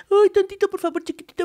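súplica muy aguda y chiquita; cortesía melosa. Acted. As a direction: exagera la amabilidad con vocecita aguda. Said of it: "llegas acá de donde todo es". (ruego empalagoso, en broma)

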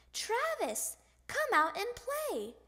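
high, singsong call to a friend; hollering. A narrator's reading. Calling friend at his house.

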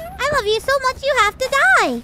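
twist the sweet line into a threat; mock creepy toy. Mocking the toy's line.

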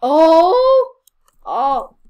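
surprised. Real, not acted. Sudden game event.

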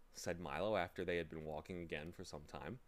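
plain reading voice

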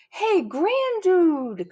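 long, high, loud holler; slow cowboy yell across the valley; cowboy calling out. A narrator's reading. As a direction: stretch out a hearty hail. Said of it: "called the cowboy".

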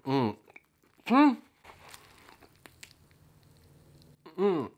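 mumbling while biting food; chewing with mouth closed; mouth full of burger. Real, not acted. Seen 4: Mouth pressed into burger, biting and chewing during the murmurs.

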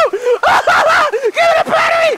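slow, high, half-sobbing shout; desperate real wail for the battery; crying desperate shout. Real, not acted. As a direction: plead at the top of your voice. Begging the game for battery.

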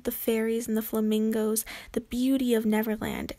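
plain reading voice

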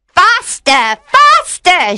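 shrill-pitched urging shout; urgent cry. A narrator's reading. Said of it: "she cried".

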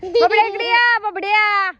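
loud, high-pitched summons; calling out. Real, not acted. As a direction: call him over from a distance. Calling Babdya to come over.

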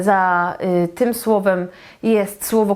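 ordinary talk

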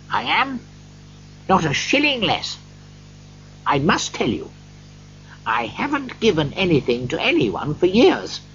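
lightly voiced Scrooge, starting high; earnest Scrooge. A narrator's reading. Scrooge admits he hasn't given for years, earnest. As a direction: confess it earnestly.

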